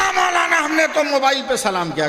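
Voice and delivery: high-pitched, protesting retort; high indignant reply from the stranger. Retold or imitated. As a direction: defend himself in a high, offended voice. Man protests his phone salaam.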